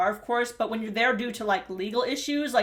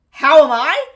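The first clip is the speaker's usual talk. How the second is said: incredulous outburst; 3 semitones higher, 13 dB louder than usual. Retold or imitated. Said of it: "The driver asks".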